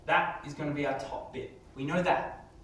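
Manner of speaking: ordinary talk, nothing marked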